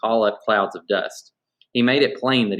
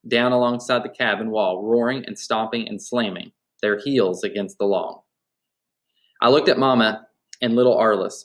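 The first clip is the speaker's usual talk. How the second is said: usual speaking voice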